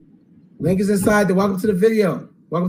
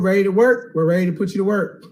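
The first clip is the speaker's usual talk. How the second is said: normal talking voice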